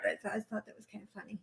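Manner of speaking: soft unvoiced whisper. Real, not acted. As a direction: whisper it as a confession. Soft aside after joke.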